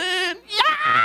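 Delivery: high, loud happy shout; joyful yell. Real, not acted. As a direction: cheer with the dancing crowd. Everybody dancing in joy.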